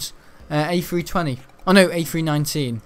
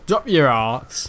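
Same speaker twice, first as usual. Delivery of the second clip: slow drawn-out plea; 3 dB louder, much slower than usual. Real, not acted. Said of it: "urging the enemy".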